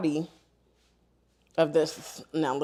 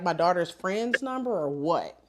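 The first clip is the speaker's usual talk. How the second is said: normal talking voice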